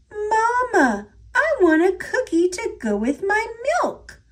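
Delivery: put-on a baby calf begging mama; little calf. A narrator's reading. Calf begs its mother.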